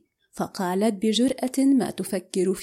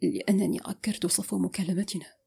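softer, subdued apology; quiet; 4 semitones lower, 6 dB quieter than usual. A narrator's reading. He apologises for spoiling the call, quietly. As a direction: say it low and quiet. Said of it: "قال بهدوء".

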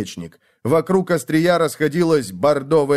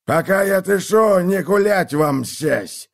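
put-on fanatical old man; 7 semitones higher, slower than usual. Fanatic old archer threat.

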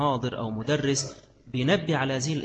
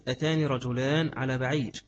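hadith recitation; 3 dB louder, slower than usual. Acted. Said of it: "لقول سعر بن ديسم".

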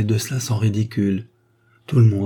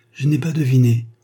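ordinary delivery, nothing marked

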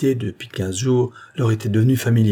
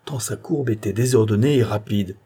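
usual speaking voice

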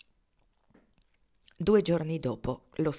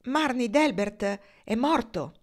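slow, loud, higher-pitched announcement; insistent; 6 semitones higher, 5 dB louder, slower than usual. A narrator's reading. Foxy breaking news to a card dealer ignoring him, insistent. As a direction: drive the news home slowly and loudly. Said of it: "insistette Foxy".

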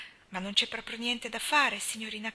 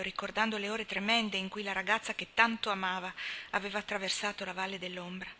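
plain reading voice

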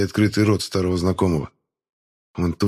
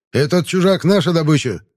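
loud, high aggressive yell; shouting; 8 semitones higher, 4 dB louder than usual. Acted. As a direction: claim the prey with a shout. Enemy claiming prey, shouting.

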